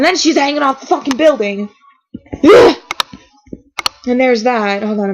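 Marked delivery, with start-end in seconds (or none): 2.4-2.8 high, loud crying ugh; anguished groan. Real, not acted. Reacting to Beckett hanging off the building, crying groan. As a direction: let out a pained cry. Overwhelmed by the episode.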